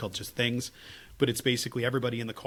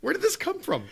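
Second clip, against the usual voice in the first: baffled exclamation; 4 dB louder than usual. Real, not acted.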